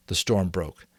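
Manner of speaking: ordinary delivery, nothing marked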